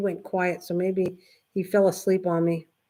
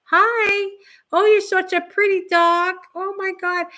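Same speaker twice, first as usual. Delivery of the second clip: put-on much higher, louder doggy baby talk; 11 semitones higher, 5 dB louder than usual. Greeting the daughter's puppy on Facebook in high baby talk. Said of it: "the head goes".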